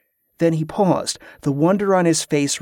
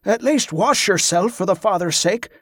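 calling out; 5 semitones higher than usual. A narrator's reading. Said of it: "Oric called after him".

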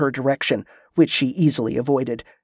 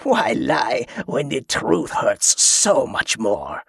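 gloating pirate. A narrator's reading. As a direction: savor every cruel word.

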